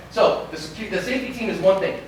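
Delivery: ordinary talk, nothing marked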